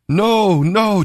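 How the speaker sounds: high, desperate cry of no. A narrator's reading. Tamar begs Amnon not to throw her out, crying out high. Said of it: "Tamar cried".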